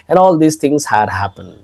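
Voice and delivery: ordinary talk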